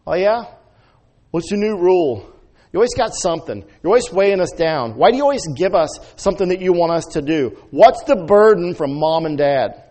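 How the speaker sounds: high, loud, sassy kids mocking their parents.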